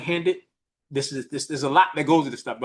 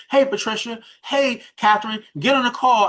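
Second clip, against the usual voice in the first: higher-pitched mock summons; calling out; 6 semitones higher, 3 dB louder than usual. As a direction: hail people by name to join in. Calling out to named listeners.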